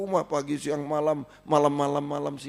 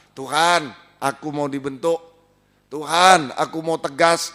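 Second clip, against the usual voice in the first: earnest prayer; 5 dB louder than usual. Acted. Voices a prayer to God.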